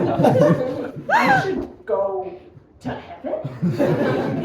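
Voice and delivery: very slow, drawn-out question, rising at the end; baffled disbelief. Acted. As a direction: stretch each word as it sinks in. Baffled repeat of cousins' advice.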